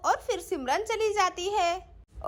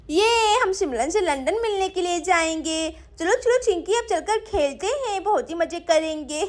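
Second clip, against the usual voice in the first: put-on little kid's giggly, eager chatter; excited little girl; 3 dB louder than usual. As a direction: play an excited child with a giggle. (चहकती छोटी बच्ची की आवाज़)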